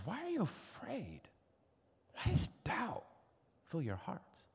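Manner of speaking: soft, high-pitched tender appeal; hushed, high, caring reproach; gentle questioning. Retold or imitated. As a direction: ask it gently, with long pauses. Jesus gently questioning frightened disciples.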